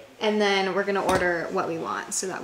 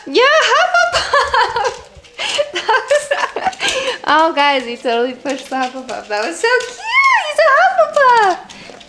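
much higher, louder, laughing cheer; excited laughing pet talk; 14 semitones higher, 10 dB louder than usual. Real, not acted. Cheering as her dog picks his house, delighted high squeal.

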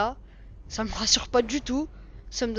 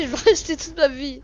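laughing; 4 semitones higher, 9 dB louder than usual. Real, not acted. Scared player jokes, laughing.

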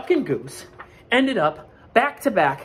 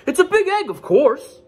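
high, loud know-it-all goose; honking goose; 7 dB louder than usual. Acted. Goose telling Duck the obvious, loud honk. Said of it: "Goose honked".

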